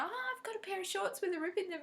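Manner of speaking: lightly voiced eager son; mock eager son. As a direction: son asking for his shorts mended. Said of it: "my son Jed's going".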